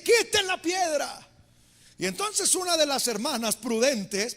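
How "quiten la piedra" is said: a firm command to roll the stone away; commanding call. Retold or imitated. Jesus commanding.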